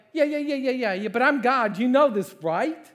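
high, loud brush-off; impatient dismissal. Acted. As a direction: cut the excuses short, acting exasperated. God brushes off Moses' excuses.

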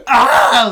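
sharp mock scream; retold howl. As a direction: yelp it out like a howl of pain. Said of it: "he apparently howled".

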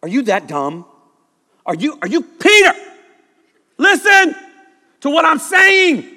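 building from slow disbelief to a high, loud cry; exasperated Jesus. Retold or imitated. Jesus scolding Peter, building to an exasperated shout. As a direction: lose patience with the slow student. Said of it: "Jesus says".

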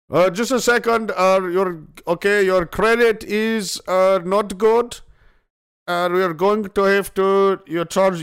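put-on high, loud, slow Indian accent; flustered Indian-accented call-center agent; Indian scam caller. Scammer telling the caller to pay up, mock Indian accent. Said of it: "This one Indian guy".

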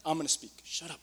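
low, very soft firm order; quiet command. Acted. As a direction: silence them without raising your voice. Acts Jesus silencing the demon.

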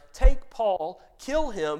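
ordinary talk, nothing marked

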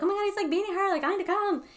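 high-pitched mock panic; retold urgent plea. Host re-enacts begging police to come, mock frantic.

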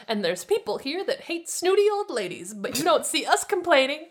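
high, indignant retort; snapping back. A narrator's reading. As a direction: snap back at a snooty stranger. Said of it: "Daphne snapped".

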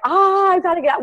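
high-pitched panicked cry; acted burst of panic, pitch leaping up. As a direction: cry out as if desperate to flee. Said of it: "this panic, this terror".

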